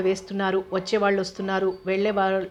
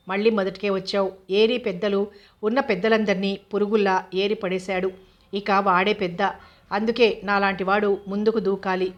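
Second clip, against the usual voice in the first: plain reading voice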